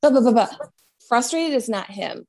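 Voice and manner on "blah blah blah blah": fast, harried mock frustration. Acting out a frustrated person rushing off, fast and exasperated. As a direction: rattle it off like a flustered person late for something. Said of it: "When you're frustrated and you're like".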